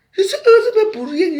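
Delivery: high, laughing and crying at once; half laugh, half sob; laughing through tears. Real, not acted. Telling her visitor she is happy, laughing through tears.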